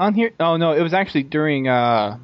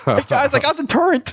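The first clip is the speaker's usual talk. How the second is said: put-on high, excited "Turrent" like a proud kid; mock excited poster; 10 semitones higher than usual. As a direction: brag it as a clueless fan, pitched up. Mocking a forum poster.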